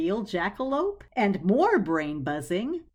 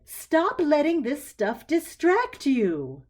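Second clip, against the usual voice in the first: slow, higher firm reprimand; self-scolding; 7 semitones higher, 5 dB louder, slower than usual. A narrator's reading. Clarissa scolding herself about distraction, slow and firm. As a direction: lecture yourself, measured.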